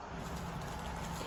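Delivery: soft gasp of wonder; breathy little mock-surprised intake; playful gasp. Feigned surprise opening a children's book.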